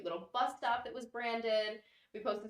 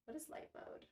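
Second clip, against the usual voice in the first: whispering; 5 semitones higher, 18 dB quieter than usual. Real, not acted. Baffled echo of a question.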